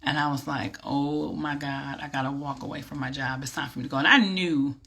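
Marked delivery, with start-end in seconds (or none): 0.8-4.0 hushed awe. Retold or imitated. Stunned realization after God speaks.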